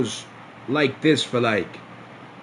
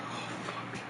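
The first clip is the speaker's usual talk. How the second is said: breathless whispered oh fuck; whispered dread; 17 dB quieter than usual. Retold or imitated. Hearing bad news from his friend, a whispered curse. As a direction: mouth it under the breath in dread.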